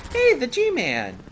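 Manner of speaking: hammed-up greeting. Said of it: "do a little bit of".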